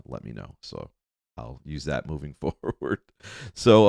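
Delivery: ordinary talk, nothing marked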